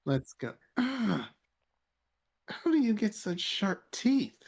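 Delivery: high, sharp gasp of pain; shocked gasp. Acted. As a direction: gasp in shock after the bite. Bitten by a fanged man.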